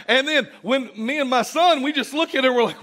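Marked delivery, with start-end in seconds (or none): none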